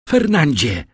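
a rebuking shout of his name; cry out. A narrator's reading. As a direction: call him out in shock and anger. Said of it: "Krzyknęła Mercedes".

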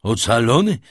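loud, startled echo of the word; astonished question. A narrator's reading. Ruined father questions his daughter's news, loud astonishment. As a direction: blurt it out in disbelief.

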